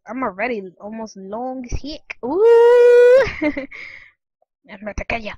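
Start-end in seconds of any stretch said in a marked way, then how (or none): 2.2-3.2 high, loud drawn-out ooh; excited whoop. Real, not acted. Gamer growing long in the game, excited whoop.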